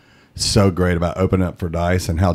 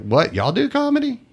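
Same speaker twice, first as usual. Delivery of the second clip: surprised question. Retold or imitated. Quoting comedian's question.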